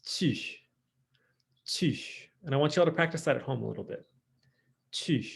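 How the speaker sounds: tu with lips pushed forward, tight French u; sharp, rounded French vowel on tu. Shown as a demonstration. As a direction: say tu through a kissy pout. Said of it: "put our lips forward".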